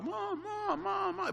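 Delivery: put-on a scared little girl crying out for her mother; frantic child calling. The little girl runs through empty rooms calling for her mother, frantic. Desperate girl searching house.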